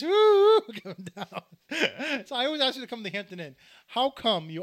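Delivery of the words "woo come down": a lightly voiced police shout, laughing; mock police call. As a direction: call out like a cop through a laugh. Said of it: "I hear the police".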